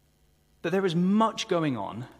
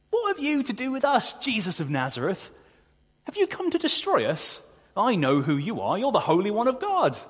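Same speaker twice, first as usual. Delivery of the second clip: high, loud, taunting demon voice; mocking demon; 8 semitones higher, 4 dB louder than usual. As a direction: sneer at him like a demon cornered by God. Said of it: "The demon's mocking".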